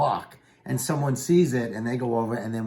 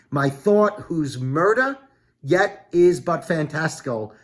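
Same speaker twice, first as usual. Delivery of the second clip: slow and louder, declaimed; dramatic recital; 4 dB louder than usual. Acted. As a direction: read it gravely, like the stage. Reads Macbeth's lines aloud.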